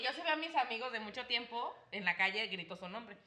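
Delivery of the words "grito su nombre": normal talking voice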